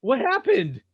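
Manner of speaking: high-pitched mock bewilderment; startled confusion. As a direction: play the baffled customer, pitch jumping up. Acting the startled drive-through customer.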